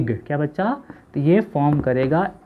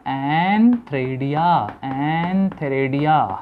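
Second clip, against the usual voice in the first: antheridia stretched syllable by syllable; slow term drill; much slower than usual. Shown as a demonstration. Teacher drilling a new term with pupils, very slowly. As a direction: draw out every part of antheridia.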